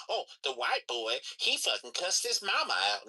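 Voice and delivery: lightly put-on, scandalized quote; mock gossiping gatekeeper. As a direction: drag it out and swoop upward in mockery. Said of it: "I just fucking hate that gatekeeping shit".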